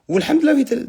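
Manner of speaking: sudden high-pitched excited outburst; excited burst. Real, not acted. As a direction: blurt it out thrilled, pitch jumping up. Excited burst about the show. (اندفاع متحمس بصوت عالي النبرة)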